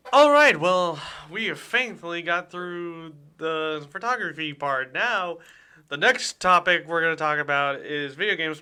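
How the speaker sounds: upbeat DJ sign-off to break; radio host announcing. Acted.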